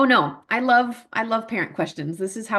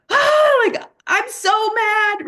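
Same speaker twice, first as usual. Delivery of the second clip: "Ah" much higher and louder, "I'm so mad" slow; intense frustration; 7 dB louder, much slower than usual. Shown as a demonstration. Parent showing a bigger angry outburst, loud and much higher. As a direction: let the anger burst out. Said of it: "say it a lot more intensely".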